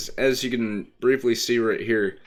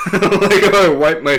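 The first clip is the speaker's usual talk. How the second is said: laughing; 8 semitones higher, 11 dB louder than usual. Real, not acted. Laughs at own yawn.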